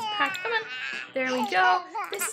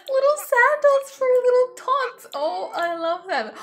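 high, loud squeal over something tiny; delighted gushing; 9 semitones higher, 7 dB louder than usual. Real, not acted. Vlogger shows tiny toddler sandals, squealing with delight. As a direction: squeal at how cute they are.

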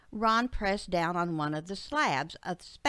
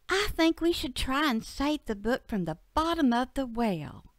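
put-on higher, eager child voice; little girl Betsy; 5 semitones higher than usual. Betsy urges her dad to rescue the book, high girlish voice. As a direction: plead like a young daughter.

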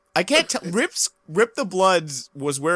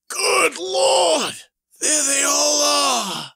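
mock villain shout; 8 semitones higher, slower than usual. Villain sees his associates in the sky, hammed-up shout. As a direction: shout it out in fake alarm.

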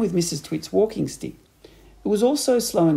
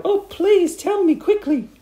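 high, loud, drawn-out begging; hammed-up frantic wife; crying out; 6 dB louder than usual. A narrator's reading. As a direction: wail it as a desperate plea. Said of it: "she cried".

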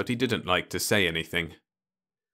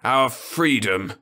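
slow, louder pointed retort; 5 semitones higher, 6 dB louder, slower than usual. Acted. Osmond answers Ludovic with a slow, pointed retort. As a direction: fire it back deliberately. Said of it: "Osmond retorted".

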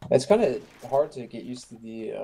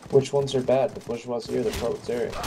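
usual speaking voice

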